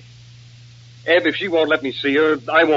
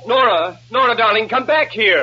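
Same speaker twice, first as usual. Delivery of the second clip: raised, higher urgent call; calling after her; 6 semitones higher, 4 dB louder than usual. Acted. Nicky calls fleeing Nora back, urgent calling. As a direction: call out to stop her leaving.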